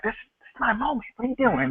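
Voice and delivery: hushed, secretive aside, fast; half-voiced whisper as if nobody else is there; imitated whisper. Wrestler whispering to a rival mid-promo, hushed and quick. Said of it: "He, he whispers to Bobby Roode".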